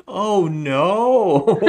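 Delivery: high, loud, over-the-top cry of oh no; hammed-up oh no. Imitating the actor playing Martin, hammed-up and loud. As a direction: ham it up like a goofy actor. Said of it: "he was hamming it up".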